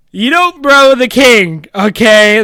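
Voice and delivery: you don't bro the king, loudly and slowly; exasperated loud. Real, not acted. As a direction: spell it out in loud disbelief. Exasperated rant at Matt.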